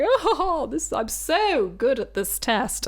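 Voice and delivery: high, slow imitation of a smug test-taker; mock boastful laughing. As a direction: laugh showily through the boast. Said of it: "laughing throughout his, his test".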